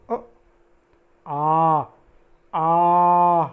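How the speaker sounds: लंबा खींचा गया आ, तीन मात्रा; आ को तीन मात्रा तक खींचकर, ऊँचे स्वर में बोलें; प्लुत स्वर. Shown as a demonstration. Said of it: "उसको उच्चारित करते समय हमें अधिक समय लेना है". (प्लुत आ, देर तक खींचकर)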